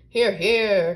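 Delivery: loud rallying call; cried agreement. A narrator's reading. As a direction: shout your support like a crowd. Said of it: "cried all the other vegetables".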